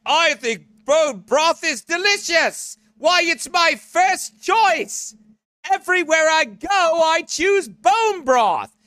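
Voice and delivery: high, slow prissy voice; mock him sweetly, drawn out; mocking Aaron. Teasing Aaron about loving bone broth, high slow mock voice. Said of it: "Aaron's like".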